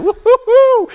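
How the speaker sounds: high, loud triumphant woo-hoo; gleeful whoop. Retold or imitated. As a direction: whoop like you just won. Cheer on finding short line.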